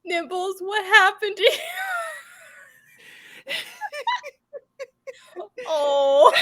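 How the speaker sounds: laughing. Real, not acted. Laughing at dog's new look.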